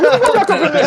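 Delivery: high-pitched, eager retold line; drunken eagerness. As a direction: act out a drunk keen to go back. Acting out his drunk eagerness.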